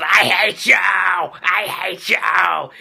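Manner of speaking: drawn-out mock-furious shout; Anakin. Defeated Anakin yells at Obi-Wan, hammed-up fury. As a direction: roar it, hammed-up and stretched. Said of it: "The Anakin goes".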